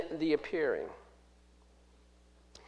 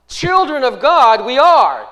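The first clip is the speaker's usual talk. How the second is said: put-on slow and loud, raised on "children of God we are"; stress on CHILDREN; 6 semitones higher, 10 dB louder than usual. Shown as a demonstration. Preacher reads Greek word order to the congregation, emphatic. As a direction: punch "children of God" up front, higher and louder. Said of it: "What's the emphasis?".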